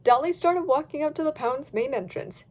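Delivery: usual speaking voice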